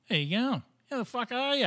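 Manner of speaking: high-pitched, jaunty Australian hello; Australian greeting. Acted. Host voices the guest greeting people, high Australian accent. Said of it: "and you'll go".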